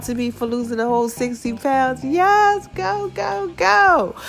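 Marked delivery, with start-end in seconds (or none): 2.0-4.2 high-pitched celebratory cheer; whooping yourself along; self-cheering. Acted. Cheering own weight loss.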